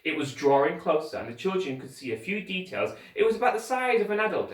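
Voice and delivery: ordinary delivery, nothing marked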